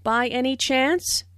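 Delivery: By any chance rising at the end on chance; rising question tune. Shown as a demonstration. Teacher modelling a rising yes-no question. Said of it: "Notice the rising intonation".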